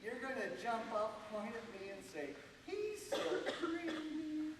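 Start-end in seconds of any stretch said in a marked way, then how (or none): 2.7-4.5 very high swooning squeal; dreamy sigh. Shown as a demonstration. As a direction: squeal it like a smitten teen. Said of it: "in your best nineteen fifties teeny bopper voice".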